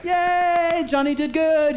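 hammed-up parent in the stands; cheering parent. Parent cheering son at ball game, mock cheer. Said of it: "they're cheering and rooting for you".

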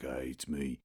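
low, soft, deep voice; Idris Elba as Knuckles. Retold or imitated. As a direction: sink into a deep movie-hero voice. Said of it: "that deep".

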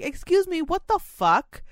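shocked outrage, high and loud, with a slow what the fuck; indignant high-pitched what the fuck; outraged high exclaim. Retold or imitated. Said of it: "But I can't say".

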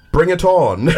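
put-on British accent. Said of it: "it just didn't sound tough 'cause he had a British accent".

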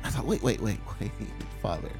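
ordinary talk

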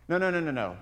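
normal talking voice

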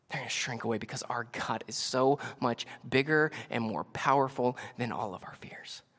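usual speaking voice

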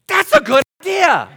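high, laughing reply; sheepish laugh. Retold or imitated. Pastor answering Corey's advice, sheepish laugh. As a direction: laugh at your own oversight. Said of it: "reply to Corey".

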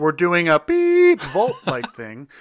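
long, loud, high BEEP in place of the name; mock censor beep. Podcaster dodging getting sued, mock censor bleep on "beep". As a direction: bleep it out with a drawn-out "beep", then laugh. Self-censors brand after lawsuit worry.

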